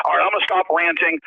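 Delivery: usual speaking voice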